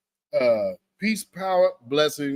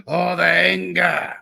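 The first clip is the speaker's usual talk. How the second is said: slow, louder hammed-up lament; mock dramatic; 3 semitones higher, 5 dB louder than usual. Host hamming up the ad's anger, mock drama. Mocking the commercial again.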